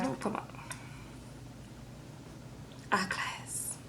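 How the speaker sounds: hushed aside. Real, not acted. Vlogger muttering to herself mid-story, whispered aside. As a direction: drop to a whisper while putting on glasses. Aside while putting glasses on.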